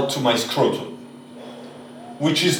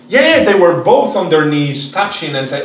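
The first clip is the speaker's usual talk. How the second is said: normal talking voice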